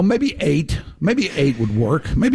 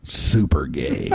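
much lower, slow and drawn out; deep slow voice; 12 semitones lower, 3 dB quieter, slower than usual. Pretend. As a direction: drop the voice way down. Punchline said deep.

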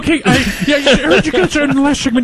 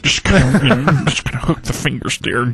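a hint of a sleazy lounge guy; low sleazy voice; 9 semitones lower than usual. Norm continues low sleazy story.

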